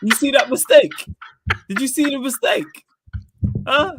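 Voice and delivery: loud, very high-pitched exasperation; indignant protest. Real, not acted. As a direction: argue back heatedly, voice cracking up into a high register. Heated defence against teasing co-hosts.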